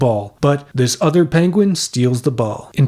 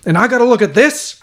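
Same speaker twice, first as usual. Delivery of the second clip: dad voice; 9 semitones higher, faster than usual. Acted. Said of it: "And Dad is like".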